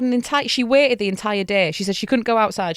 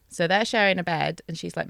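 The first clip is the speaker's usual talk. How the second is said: usual speaking voice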